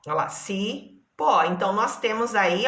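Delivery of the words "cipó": "cipó" as ci, then pó; syllable by syllable. Shown as a demonstration. Teacher points out two syllables, "cipó" split ci, pó. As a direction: separate both syllables of "cipó". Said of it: "duas palavras que têm duas sílabas".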